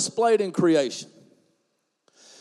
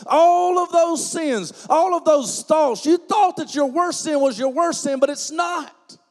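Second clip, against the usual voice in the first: preaching at full force; 5 semitones higher than usual. Acted. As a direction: drive it home with rising fire. Preaching climax on sin.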